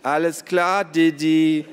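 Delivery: high, loud mocking singsong; gleeful bickering jab; teasing taunt. A narrator's reading. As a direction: needle them with the nickname. Teasing nickname in squabble.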